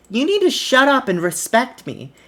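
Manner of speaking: a hammed-up stern order; mock demand. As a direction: snap back with mock authority. [name] demanding respect.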